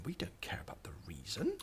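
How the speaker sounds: breathy unvoiced aside, trailing off; whispering. Real, not acted. Offhand aside while coding.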